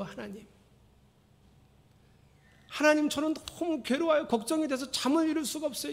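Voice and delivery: high, pleading prayer full of worry; anxious pleading prayer. Shown as a demonstration. Pastor demonstrating a worried, begging prayer to avoid. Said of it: "이렇게 기도하지 말고".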